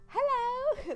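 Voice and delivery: very high, loud country hello; high-pitched country voice. Shown as a demonstration. Showing a high-pitched country greeting, piercingly high. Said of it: "like, high pitch".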